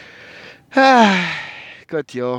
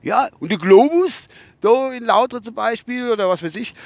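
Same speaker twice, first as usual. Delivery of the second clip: driver's voice; 8 semitones higher than usual. Retold or imitated. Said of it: "hab ich gesagt".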